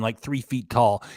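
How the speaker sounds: ordinary talk, nothing marked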